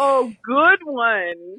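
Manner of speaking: hammed-up slow approval; imitated amused reply. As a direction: stretch it out as mock appreciation. Retells the man's amused reply.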